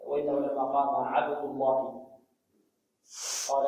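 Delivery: usual speaking voice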